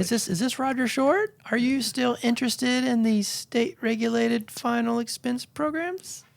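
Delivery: very high-pitched, over-questioning caller; hammed-up insecure telemarketer, asking permission; insecure salesperson. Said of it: "the insecure salesperson".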